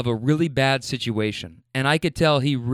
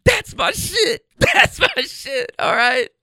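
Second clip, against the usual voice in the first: high, slow, voice breaking with emotion; fighting back tears while celebrating; choked up; 12 semitones higher, 3 dB louder, slower than usual. Real, not acted. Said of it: "I'm getting emotional".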